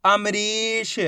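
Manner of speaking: अमरीश with a long, held री; teacher drawing out अमरीश; blended slow reading. Shown as a demonstration. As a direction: join अमरीश slowly, stretching the ee. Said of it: "एक ही अक्षर का उच्चारण करके".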